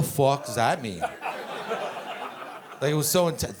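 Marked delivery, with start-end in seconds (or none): none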